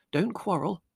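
high-pitched motherly plea; placating mother. A narrator's reading. Mother asking the family not to quarrel, placating. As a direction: gently chide the squabblers.

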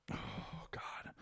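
breathy embarrassed aside; whispered. Real, not acted. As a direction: mutter it under your breath.

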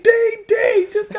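high laughing yell; desperate shout. Retold or imitated. Uncle desperate for toilet paper, high yell. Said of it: "He's like".

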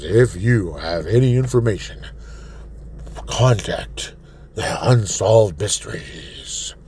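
slow hushed whisper of a TV mystery host. Retold or imitated. Host imitating the old Unsolved Mysteries host, slow whisper. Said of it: "the old, old host of Unsolved Mysteries".

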